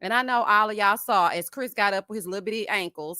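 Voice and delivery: ordinary delivery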